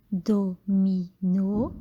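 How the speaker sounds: Domino said slowly as do-mi-no; teacher scanning a word for pupils; scanned by syllable. Shown as a demonstration. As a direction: beat out Domino one syllable at a time. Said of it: "vous allez scander le nom".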